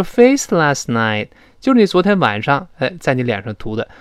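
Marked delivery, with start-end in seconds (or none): none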